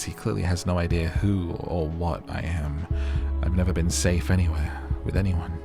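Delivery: usual speaking voice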